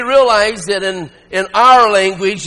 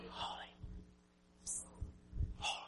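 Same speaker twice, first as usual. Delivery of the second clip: faint holy; 33 dB quieter, faster than usual. Shown as a demonstration. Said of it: "they weren't just going".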